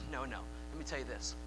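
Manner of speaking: hushed, tender reassurance; tender Jesus. Acted. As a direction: gently reassure a broken friend. Jesus voiced tenderly to Peter.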